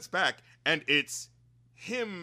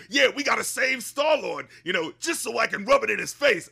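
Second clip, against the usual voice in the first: mock gruff quote; 6 semitones higher, 4 dB louder than usual. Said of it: "he says that one line was like".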